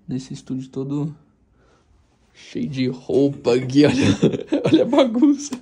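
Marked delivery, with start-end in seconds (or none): 2.3-5.6 loud laugh through the words. Real, not acted. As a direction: laugh out while showing the mess. Laughs at messy room. (rindo alto)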